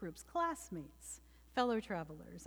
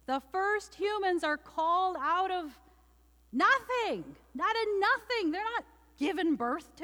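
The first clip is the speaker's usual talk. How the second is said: higher, louder preaching build; preaching at full force; 7 semitones higher, 4 dB louder than usual. Real, not acted. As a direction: preach it with rising fervor.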